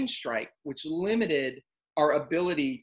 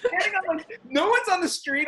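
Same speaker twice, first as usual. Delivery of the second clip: laughing; 13 semitones higher, 5 dB louder than usual. Real, not acted. Laughing while retelling.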